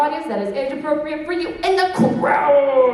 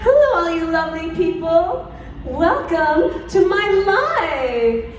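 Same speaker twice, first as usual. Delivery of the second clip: idol greeting; 7 semitones higher than usual. Shown as a demonstration. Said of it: "take center stage and say".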